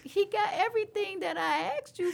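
high, protesting whine, half laughing; pleading with God. Retold or imitated. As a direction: mock-desperate bargaining.

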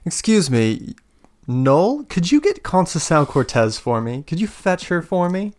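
a hint of a commanding villain; voice from the fire. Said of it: "you hear the voice go".

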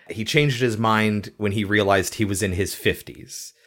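ordinary talk

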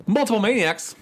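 high, hyped show announcer. Acted. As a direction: announce it like a ring card.

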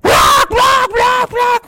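very high shouted squawks; parrot squawk. Acted. Parrot squawking as the cage opens, very high shouted squawk. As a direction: screech like a freed parrot.

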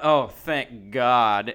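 imitating a kid's long, loud THANK GOD; drawn-out relief, imitated. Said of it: "that's how he said it".

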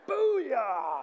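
loud, high celebratory whoop; exuberant cheer. Real, not acted. Preacher celebrating Johnny in front of the congregation, whooping. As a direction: let out a big drawn-out shout.

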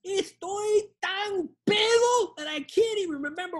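put-on high, slow drawn-out drunken boast; acted drunk. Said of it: "If you're really drunk".

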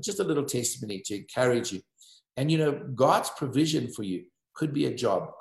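ordinary delivery

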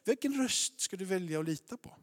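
ordinary talk

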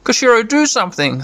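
high pleading creature voice; Tentomon voice. Acted. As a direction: pitch it up as the little bug pleading. Tentomon voiced in game playthrough.

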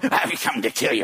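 lightly voiced demon threat. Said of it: "a voice is going".

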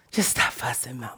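soft, low coaxing to calm her mother; gentle hush. Retold or imitated. To her screaming mother, a soft low coaxing plea.

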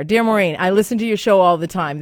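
loud, projected radio reading; emphatic on-air letter reading; letter read aloud. Acted. Reads a listener letter aloud.

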